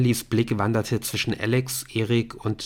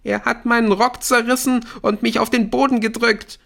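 high, loud tearful outburst; louder wailing; 14 semitones higher, 6 dB louder than usual. A narrator's reading. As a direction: wail the accusation out. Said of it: "Aisha heulte lauter".

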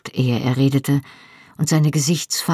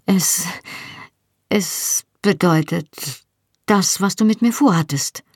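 halting, higher, faintly flustered; caught off guard; 4 semitones higher than usual. A narrator's reading. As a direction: stumble into the explanation, taken by surprise. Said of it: "war überrumpelt und musste zittrig lachen". (überrumpelt, stockend, etwas höher)

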